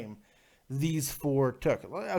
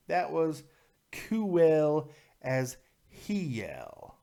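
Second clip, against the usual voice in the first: drawn-out emphasis; much slower than usual. Real, not acted. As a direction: stretch COOL and HELL slowly, pausing between. Repeats phrase slowly for emphasis.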